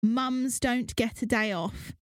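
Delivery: each word spaced out slowly; wife slowly quoting husband's line, mocking; slow deliberate quote. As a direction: read his line out slowly, for effect. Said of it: "Dan says".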